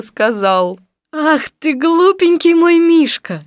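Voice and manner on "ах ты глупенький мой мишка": high, drawn-out fond voice; affectionate. Acted. Christopher Robin to stuck bear, tenderly. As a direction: coo it loudly and slowly with love. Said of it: "Таким ласковым голосом".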